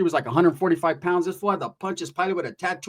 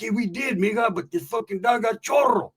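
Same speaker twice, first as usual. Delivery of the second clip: defensive excuse; 4 semitones higher than usual. Retold or imitated. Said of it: "He's like".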